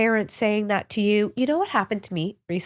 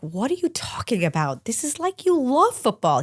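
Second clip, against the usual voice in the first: half-laughing protest; incredulous; 6 semitones higher than usual. Retold or imitated. Mother protesting to her son who quits football, incredulous.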